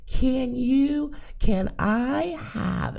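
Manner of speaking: high, slow, every word crisp; over-enunciate like she can't understand; crisp and clear. Retold or imitated. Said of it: "pronouncing every word crisp and clear".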